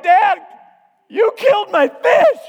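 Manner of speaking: put-on high, loud, drawn-out child's wail; mock a little sister wailing an accusation; imitated bawling. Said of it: "She's bawling, bawling".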